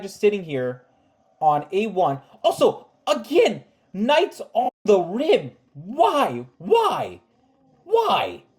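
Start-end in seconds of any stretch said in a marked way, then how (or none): 2.4-8.4 drawn-out, climbing cries of exasperation; exasperated. Real, not acted. Chess coach lamenting knights on the rim, exasperated. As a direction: plead in mounting disbelief, pitch leaping higher.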